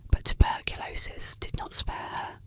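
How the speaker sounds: plain reading voice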